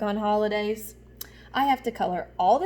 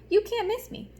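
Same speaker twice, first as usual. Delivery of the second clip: proud boast; 10 semitones higher than usual. Acted. Yellow crayon brags about the sun.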